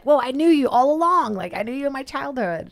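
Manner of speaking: high, amazed and starstruck. Retold or imitated. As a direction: gush in delighted surprise. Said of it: "you're like".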